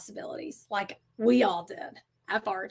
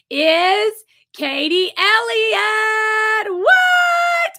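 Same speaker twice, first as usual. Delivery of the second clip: drawn-out game-show style shout; excited winner announcement; 8 semitones higher, 8 dB louder, much slower than usual. Real, not acted. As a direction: build to a loud, high, elated whoop. Announcing the comment winner live.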